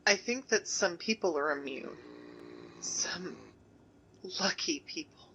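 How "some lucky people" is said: hushed whisper while hiding. Acted. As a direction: breathe it, scared of being heard.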